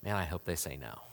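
low, hushed private aside; little inner voice. Acted. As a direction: think it aloud, soft and low. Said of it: "this little voice inside us".

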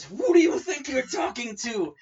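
loud, high outraged voice; outraged vendor yelling at Mama; indignant yell. Retold or imitated. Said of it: "the lady goes".

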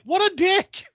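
loud, very high mock outrage; high-pitched comic exclamation; indignant reaction. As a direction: squeal the insult in disbelief. Acts own reaction to dad.

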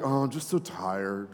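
low, soft droning sigh of a tired twenty-something; mock tired young person. Young people answering the pastor, low and drained. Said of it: "They're like".

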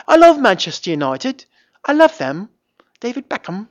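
put-on high, bouncy take on a British accent; sing-songy British accent. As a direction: imitate a lilting British accent. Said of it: "she was very sing-songy like that".

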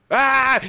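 high mock yell; retold angry cry. Father snaps after the woman refuses him, mock angry yell. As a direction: imitate an enraged outburst, pitched well up.

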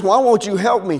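high, acted protest; urgent plea.

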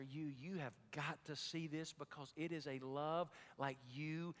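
hushed. Retold or imitated. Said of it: "he says".

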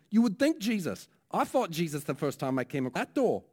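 wry New Zealand preacher conceding the point; New Zealand accent. Retold or imitated. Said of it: "my New Zealand accent".

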